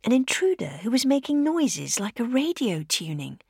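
ordinary delivery, nothing marked

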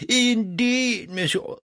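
high, emphatic confirmation; triumphant French impresario. Acted. Legras confirms Mozart has no choice, haughty. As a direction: seal the victory haughtily.

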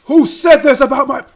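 very high, very loud outraged orc yell; angry orc scream. Acted. Angry orc demanding who insulted his mother, screaming. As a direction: roar it out as an enraged orc. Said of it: "you hear him scream like".

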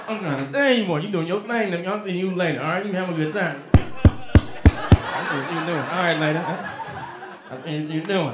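put-on slow drawn-out mumbling old man; mumbling old uncle. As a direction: mutter it slowly as the old uncle. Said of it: "he start mumbling".

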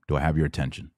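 ordinary talk

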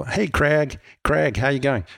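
imitated Minneapolis greeting, Craig as "Cregg"; Minneapolis accent. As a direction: mimic a Minneapolis American saying hey. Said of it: "living in Minneapolis for a little while".

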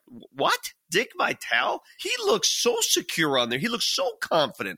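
high-pitched, amazed reaction; incredulous disbelief. Retold or imitated. Interviewer voicing their surprise at reading about the guest's insecurity, incredulous.

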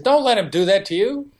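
mock yelling at him, not full force; retold yell. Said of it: "Gabriella yelled at him".

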